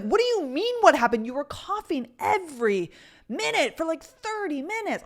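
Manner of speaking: high, loud disbelieving comeback; incredulous retort. Retold or imitated. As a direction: throw his question back at him, baffled.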